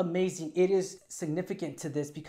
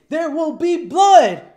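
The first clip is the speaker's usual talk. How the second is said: loud, high, drawn-out bellow; dramatic shout; 12 semitones higher, 16 dB louder, slower than usual. Acted. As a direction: roar it out slowly like a grand proclamation. Bellows a famous film title.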